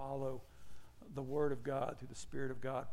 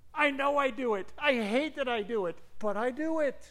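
raised, high-pitched admission of fault; exasperated confession; 8 semitones higher, 7 dB louder than usual. Retold or imitated. Preacher admitting a habit he hates, loud exasperation.

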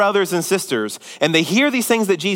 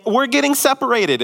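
a mild mock outburst, pitched higher; losing it; 9 semitones higher than usual. George's mom storming out on her husband, mock upset. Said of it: "George's mom loses it".

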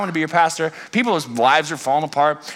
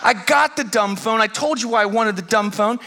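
exasperated customer; 6 semitones higher than usual. Retold or imitated. Dad arguing with the clerk. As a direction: push back in mock exasperation. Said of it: "I'm like".

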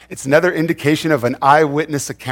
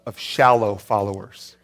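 normal talking voice

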